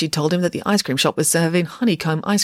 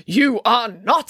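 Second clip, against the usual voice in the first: slow, firm refusal; flat refusal; 5 semitones higher, 4 dB louder, much slower than usual. A narrator's reading. Nanny Piggins refuses the headmaster, slow and firm. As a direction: lay down the law slowly. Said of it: "said Nanny Piggins".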